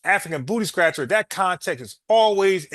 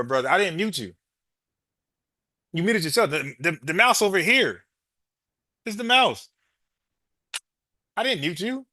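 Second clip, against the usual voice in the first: usual speaking voice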